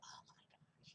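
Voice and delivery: hushed, voiceless awe; imitated happy gasp, barely audible; mock delighted whisper. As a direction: whisper it as if overjoyed. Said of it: "He's so happy".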